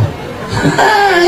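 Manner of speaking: a high, far-off burst of laughter. Real, not acted. Preacher laughing at his own joke to the congregation.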